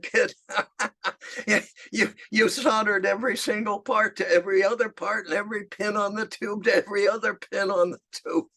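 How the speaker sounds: high and fast through chuckles; laughing disbelief. Retold or imitated. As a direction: imitate a man laughing at the kid's mistake. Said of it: "And he laughed and he laughed".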